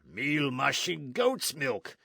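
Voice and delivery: slow, rough oath of dismay; mild mock exclamation; exasperated cry. A narrator's reading. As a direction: blurt it out like a soldier's curse. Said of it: "Gonzalez cried".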